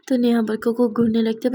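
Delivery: ordinary delivery, nothing marked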